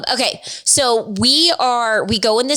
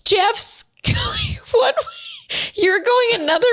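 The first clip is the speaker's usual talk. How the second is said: high, laughing mock shout; laughing retelling; 12 semitones higher than usual. Wife retelling her friend's account of them running in circles, laughing. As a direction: squeal it through laughter. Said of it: "And she said".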